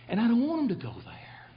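breaking into a whisper; real tearful near-whisper; choked-up whisper. Real, not acted. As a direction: voice giving out with emotion. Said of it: "And we lost it".

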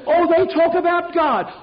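high-pitched mimicry of an objector; mock protest. Preacher mimics listeners defending the politicians, mock protest.

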